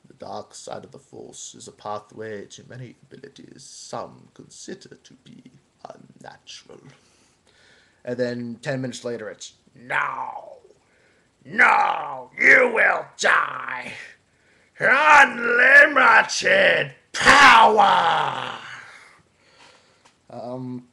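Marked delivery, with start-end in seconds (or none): 0.1-6.8 low, soft Emperor rasp; a hint of a sinister old emperor; Palpatine voice. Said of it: "this is, of course, the Yoda voice". 9.8-18.6 slow, high, shouted Emperor cackle-rant; stretched roaring villain climax. Acted. Star Wars Emperor impression.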